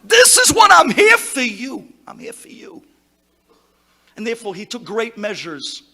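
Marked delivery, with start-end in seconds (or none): 0.0-1.9 loud high insistent cry; rabbi's loud reassurance. Retold or imitated. Rabbi reassuring the worried visitor, loud and high. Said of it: "No, I'm bothering the rabbi". 2.1-2.8 breathy whispered repeat; whispered echo. Retold or imitated. Rabbi's promise echoed to congregation, whispered. Soft echo after shout.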